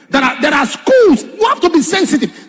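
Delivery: loud, high preaching cry; preaching shout. Real, not acted. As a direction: proclaim it loudly to the congregation. Preacher drives home a warning.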